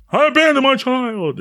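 half-anguished take; rising mock anguish. Shown as a demonstration. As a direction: start to wail it. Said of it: "It's not serious".

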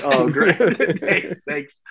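high, laughing mock gratitude; sarcastic thanks. Sailor to his unhelpful chief, sarcastic and laughing. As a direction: retell useless thanks through laughter.

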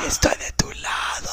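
conspiratorial whisper to the nephew. Acted. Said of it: "Te voy a susurrar algo".

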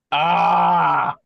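loud frustrated yell; frustrated shout. Real, not acted. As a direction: shout out in dismay. Player panics at spy news.

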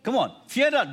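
high, loud rallying call; loud urging. Shown as a demonstration. Preacher urges the congregation to repeat, loud. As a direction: urge the whole room to say it. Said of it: "look at someone and tell the person this".

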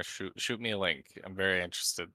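ordinary talk, nothing marked

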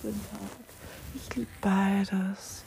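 slow, hushed whisper. Acted. Musing to viewers about night and day, whispered. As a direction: let it out as a soft secret.

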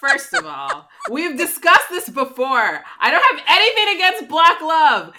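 high, loud, insistent denial; emphatic protest. Real, not acted. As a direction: swear you mean it, too loudly. Exaggerated defensive denial.

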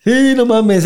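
high wailing no, cracking upward; hammed-up teary wail; mock drunk crying. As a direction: whimper it like a drunk in tears. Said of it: "empecé a llorar".

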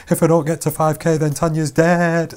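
half-joking desperate self-talk; 1 mock panic. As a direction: spur yourself on in a mock panic. Panicked thoughts while running.

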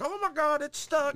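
hammed-up doctors at a stuck birth; panicked delivery doctors. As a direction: squeal it like a panicked nurse. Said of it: "they were like".

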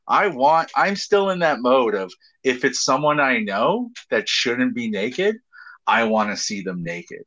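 ordinary talk

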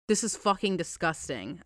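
normal talking voice

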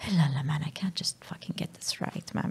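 muttered self-reproach in a whisper; frustrated whisper. Retold or imitated. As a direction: hiss it under your breath at yourself. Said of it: "And I'd be like".

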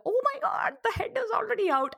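a stunned nurse blurting it out fast; shocked nurse. Retold or imitated. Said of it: "the nurse was like".

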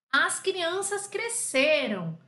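slow, emphatic model sentence. Shown as a demonstration. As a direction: set up the example sentence slowly. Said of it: "aqui agora eu coloquei um sujeito". (frase modelo, bem devagar)

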